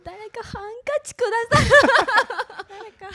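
high, loud, laughing through tears; tearful laughing plea. Real, not acted. Actress in tears calling for a handkerchief, high and laughing. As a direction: call out for a hankie half laughing, half crying.

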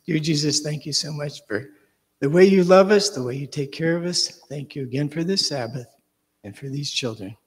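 gentle, earnest prayer voice. Acted. Closing prayer for the children, gentle and earnest.

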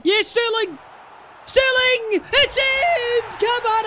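high, loud shout with the voice cracking; real ecstatic yell, breaking up high; voice-cracking goal celebration. Real, not acted. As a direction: scream the goal in until the voice cracks. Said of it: "I've cracked the voice crack".

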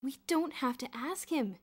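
keen, emphatic reassurance; earnest. A narrator's reading. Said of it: "Bruno replied earnestly".

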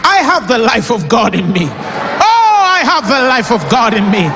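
impassioned preacher's outburst; exultant declaration. Shown as a demonstration. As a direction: proclaim it like a triumphant confession. Models exultant declaration of faith.

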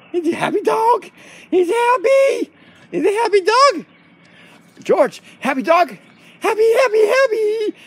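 high, stretched cooing; gushing pet talk. Real, not acted. Owner gushes over happy George, high excited cooing.

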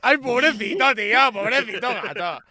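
loud, high cry of pity. Real, not acted. Pitying the cat in the clip.

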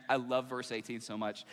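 ordinary talk, nothing marked

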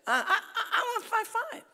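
put-on high, loud stammering child demand; whiny little girl. Girl demands to grow another inch, high whiny child voice. Girl insisting on more height.

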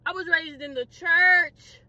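slow, stretched-out indignation; drawn-out protest. Acted. Preacher's kid facing arrest, slowly drawn-out protest. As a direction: drag the words out for emphasis. Second take, dragged out.

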